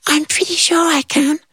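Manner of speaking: very high tiny voice; tiny ant voice. Acted. Tiny ant offering help, very high squeak.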